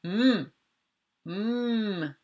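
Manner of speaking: "Hmm" as a held closed-lip m; isolated m sound. Shown as a demonstration. Teacher quizzing pupils on a sound, "Hmm" hummed long. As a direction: hum each "Hmm" steadily, then stop. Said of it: "Qu'est-ce qui fait ça?".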